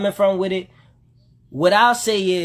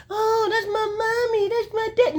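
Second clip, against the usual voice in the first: mocking whiny kid; 4 dB quieter than usual. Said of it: "We too scared".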